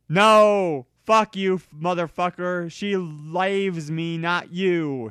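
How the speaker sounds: loud, high furious shout; Vampire shouting at Draco; angry shout. Acted. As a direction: scream it back at Draco. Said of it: "shouted Vampire".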